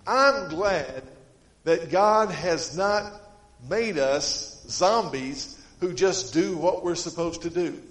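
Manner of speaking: loud, slow, emphatic sermon delivery; fervent preaching. Acted. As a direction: drive each phrase home to the church. Preaching at full force.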